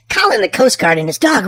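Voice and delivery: ordinary delivery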